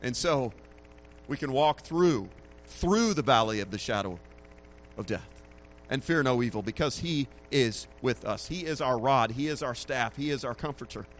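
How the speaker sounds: ordinary talk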